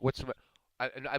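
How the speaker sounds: usual speaking voice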